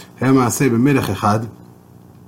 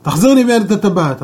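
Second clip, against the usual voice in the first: raised, higher angry shout; furious king yelling for the waiter; 6 semitones higher, 4 dB louder than usual. Acted. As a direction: bellow the order at the servants. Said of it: "המלך התחיל לצעוק".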